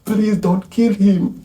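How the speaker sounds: high, drawn-out acted plea; begging. Woman begs for her lover's life, high pleading. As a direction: beg slowly, pitched right up. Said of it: "Ntewa is begging".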